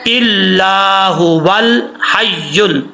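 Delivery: इल्लाहु वल हय्युल chanted slowly, the वल linked straight on; joined recitation. Shown as a demonstration. Teacher showing students how to join the words, slow chant. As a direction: chant इल्लाहु वल हय्युल as one slow line without stopping. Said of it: "अगर मिला के पढ़ना चाहेंगे तो".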